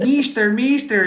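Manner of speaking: put-on high, loud giggling kids' voices; kids laughing. Schoolkids laughing at their teacher, high giggly voices.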